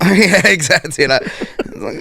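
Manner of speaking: ordinary talk, nothing marked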